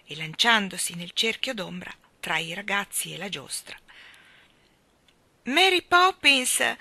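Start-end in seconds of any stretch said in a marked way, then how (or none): 5.4-6.8 slow, high, loud fearful call; trembling cry. A narrator's reading. Jane calling Mary Poppins, suddenly afraid. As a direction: call her back in sudden fear. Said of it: "gridò Jane con la voce tremante".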